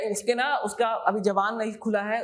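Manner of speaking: ordinary talk, nothing marked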